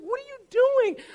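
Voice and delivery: high-pitched mock-indignant teacher; startled scolding teacher. British piano teacher catching pupil falling asleep, scolding. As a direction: squeal it like an appalled piano teacher.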